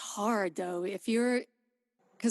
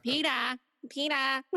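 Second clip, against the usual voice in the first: Family Guy Rhode Island; 3 semitones higher than usual. Retold or imitated. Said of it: "gonna sound like Family Guy".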